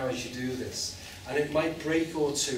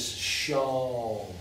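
"sharm" stretched long and slow; drawn-out sound demo. Shown as a demonstration. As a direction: linger on "sharm", closing on the M. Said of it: "as long as you can do it".

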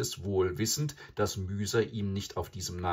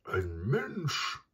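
higher, startled animal voice; raised voice of a snorting cow; snorting buffalo cow; 4 semitones higher than usual. A narrator's reading. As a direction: puff out the alarm like a buffalo.

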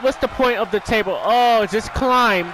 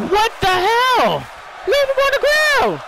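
exasperated yell; 12 semitones higher than usual. Real, not acted. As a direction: yell at the dumb wrestlers. Yelling at wrestlers on screen.

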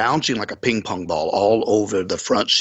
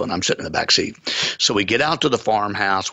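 ordinary delivery, nothing marked

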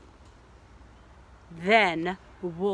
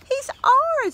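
high, louder proud claim; proud Papa; 6 dB louder than usual. Acted. Papa claiming the baby wolf, high and proud. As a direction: declare it like a delighted dad.

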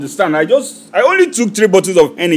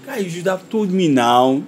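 usual speaking voice

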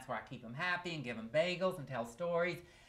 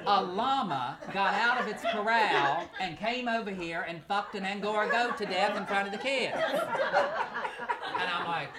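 louder, laughing through every phrase; can barely get the words out for laughing; laughing while telling; 6 semitones higher, 7 dB louder than usual. Real, not acted. Retelling the llama incident, cracking up.

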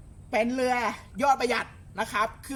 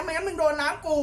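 high, teasing threat as the boat; mock boat voice; 7 semitones higher than usual. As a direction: warn the other boats off in a high mock voice. Boat voiced warning others off. (เสียงแกล้งทำเป็นเรือขู่ เสียงสูง)

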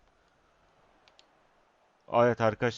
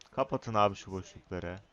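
normal talking voice

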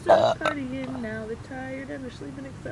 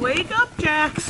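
calling out; 7 semitones higher, 9 dB louder, slower than usual. Real, not acted. Waking a dog, calling out.

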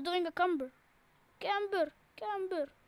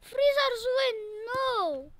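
much higher and louder cry; frustrated outburst; 8 semitones higher, 7 dB louder than usual. Real, not acted. Losing player cries out as the freezers win the round. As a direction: blurt it out as the round is lost.

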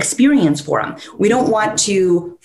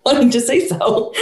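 laughing; 4 semitones higher than usual. Retold or imitated. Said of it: "the dog's like".

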